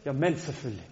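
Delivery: put-on low tough-guy street accent. Boy proving he is a man to Josef, macho street accent. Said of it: "Så talte jeg sådan en accent".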